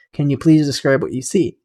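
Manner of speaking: ordinary delivery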